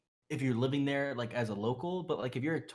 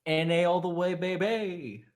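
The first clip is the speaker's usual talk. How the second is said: louder, higher rallying chant; mock cheer; 5 semitones higher, 4 dB louder, slower than usual. Co-host cheering America's world first, chanted hype. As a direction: draw it out like a proud fan.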